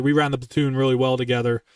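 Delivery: ordinary delivery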